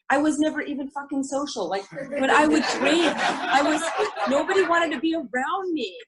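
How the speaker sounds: laughing through it, pitch rising high. Real, not acted. Laughing at her old drinking self.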